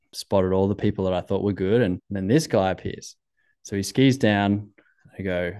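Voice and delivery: ordinary talk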